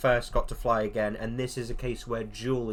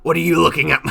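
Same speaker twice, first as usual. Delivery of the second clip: put-on tough-girl snarl; 5 semitones higher, 14 dB louder, much faster than usual. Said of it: "that one reel".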